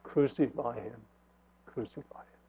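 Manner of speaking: crucify him said low and soft, the second almost whispered; a hushed echo of the crowd's cry; low, fading crucify him; hushed mock crowd cry. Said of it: "they ended up saying what?".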